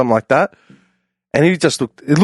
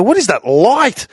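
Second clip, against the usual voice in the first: put-on drunk surprise; 10 semitones higher, slower than usual. Dazzled by dawn, drunk.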